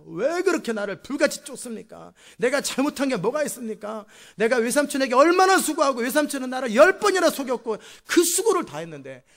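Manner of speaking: loud, high, heated outburst; angry protest. Acted. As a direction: let long-held grievances burst out at full volume. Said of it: "야곱이 막 화를 내면서". (화를 내며 큰 소리로 따지기)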